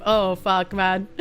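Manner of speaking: normal talking voice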